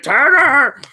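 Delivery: loud, very high drawn-out holler; yelling out. Pretend. Game master plays Pete at the truck window, yelling high and loud. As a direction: belt out the name as a cry for help. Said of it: "yelling out of it".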